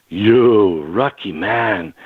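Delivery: slow, drawn-out and low; marvelling policeman. Retold or imitated. Policeman to the man he let go, slow and marvelling. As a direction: stretch each word out in low-pitched awe.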